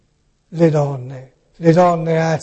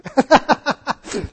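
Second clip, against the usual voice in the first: real laugh; 9 semitones higher than usual. Real, not acted. Laughs at own sweater.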